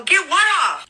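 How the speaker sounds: high-pitched incredulous question; retold bewildered reply; baffled retort. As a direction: act out your baffled comeback, voice jumping up high. Her baffled reply, acted out.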